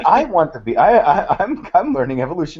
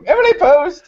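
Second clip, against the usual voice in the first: prim high voice; 5 dB louder than usual. Pretend. Mock etiquette sign-off.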